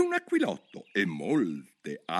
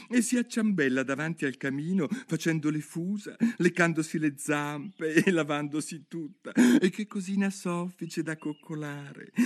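gushing; 9 semitones higher than usual. Acted. Alice gushes about Dina to the mouse, rising excitement. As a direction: rave about the cat.

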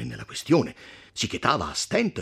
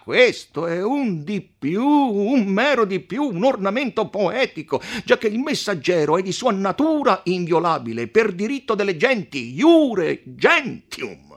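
a magistrate shouting his Latin at the count; shouting podestà; 12 dB louder than usual. A narrator's reading. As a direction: hammer each legal point home. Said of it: "replicava non meno urlando il podestà".

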